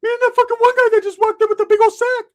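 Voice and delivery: a shrill imitation of the friend; Ricky's voice. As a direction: squeal it like Ricky. Ricky answers.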